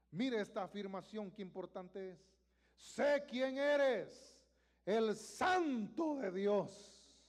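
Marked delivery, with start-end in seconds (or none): 2.8-7.2 very slow, loud, high demonic declaration; loud slow demonic proclamation; demon declaring. Acted. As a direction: stretch every word out like a defiant spirit. Demon voiced in Bible reading.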